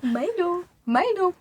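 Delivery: high cooing name call; pet call. Acted. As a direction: call a little pet over sweetly, high. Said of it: "I'm like".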